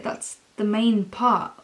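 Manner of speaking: ordinary talk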